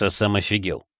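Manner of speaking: plain reading voice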